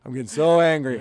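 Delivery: real angry outburst. Real, not acted. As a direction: let the anger burst out on so. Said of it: "That's how you know I'm angry".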